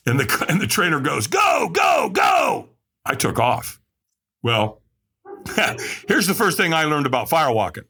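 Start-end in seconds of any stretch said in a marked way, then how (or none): 1.3-2.7 imitating a trainer's urgent shout, much higher. Trainer shouting the firewalker onto the coals, urgent yell. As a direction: launch him onto the coals.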